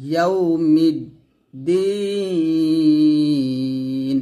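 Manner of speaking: ইয়াউমিদ্দিন sung out very slowly with a long held vowel; drawn-out recitation. Shown as a demonstration. Teacher recites for the class to copy, long stretched chant. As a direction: draw ইয়াউমিদ্দিন out in slow chant. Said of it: "এরকম ভাবে একসাথে".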